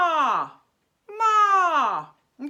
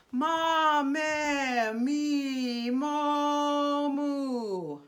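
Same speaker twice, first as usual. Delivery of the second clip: sustained vowel drill; much slower than usual. Shown as a demonstration. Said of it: "play around with these vowels".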